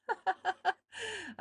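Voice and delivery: high, breathy burst of laughter; real laugh. Real, not acted. Artist blowing pastel dust off her page, laughing high. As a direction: laugh at your own mess.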